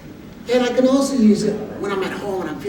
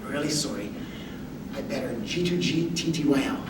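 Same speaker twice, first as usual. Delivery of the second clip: much softer, lower, tender near-whisper; quiet and loving; 5 semitones lower, 11 dB quieter than usual. Retold or imitated. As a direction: hush it lovingly to your wife. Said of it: "whisper really quietly and lovingly".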